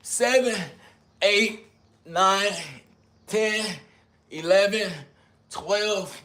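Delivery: straining count while doing push-ups. Real, not acted. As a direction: gasp each number out mid push-up.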